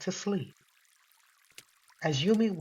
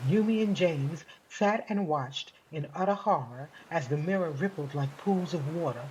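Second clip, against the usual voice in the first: ordinary delivery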